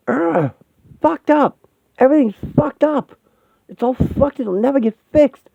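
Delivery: high-pitched frantic despair; anguished outburst. Acted. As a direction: cry out that all is ruined.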